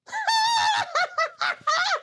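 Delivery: high shrieking laugh. Retold or imitated. Colleague cracks up mid-meeting, shrieking laugh. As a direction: burst out laughing uncontrollably.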